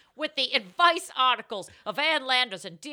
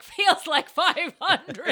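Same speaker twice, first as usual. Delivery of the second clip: slow, dry, laughing deadpan; sarcastic drawl; 5 semitones higher, 5 dB louder, much slower than usual. Retold or imitated. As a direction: drag it out wearily with a laugh. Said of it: "I'm like".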